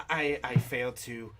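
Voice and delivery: usual speaking voice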